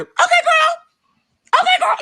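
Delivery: very high squeaky voice; mock agreeable. Hypothetical reply to tired sister, high mock agreeable. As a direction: squeal it in exaggerated understanding.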